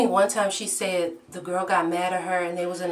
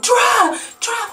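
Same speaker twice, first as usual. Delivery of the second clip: much higher, loud frantic urging; panicked friend; 8 dB louder than usual. Retold or imitated. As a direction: yell it like the car is chasing you. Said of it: "my friend was like".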